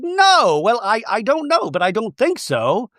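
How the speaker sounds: exaggerated high-voiced waffling; mock hasty denial. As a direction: squeal the denial, then back off. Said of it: "And Bronwyn's like".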